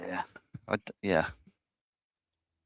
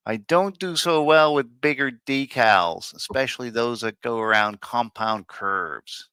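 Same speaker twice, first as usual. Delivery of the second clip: put-on terrible Chicago accent; 4 dB louder than usual. Host reads Jim's decal message in a bad Chicago accent. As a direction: have a clumsy go at a Chicago accent. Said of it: "this is a terrible Chicago accent".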